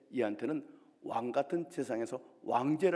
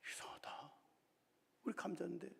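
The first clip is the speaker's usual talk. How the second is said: hushed, muttering to himself in confusion; puzzled murmur; 2 semitones higher, 11 dB quieter than usual. Acted. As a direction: mumble it softly, baffled.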